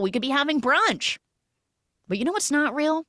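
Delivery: high-pitched, animated mock reasoning; high breezy protesting. Captor to tied-up woman about having brunch, high and animated. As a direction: plead the case in a high, lively voice. Acting out upbeat, pleading captor.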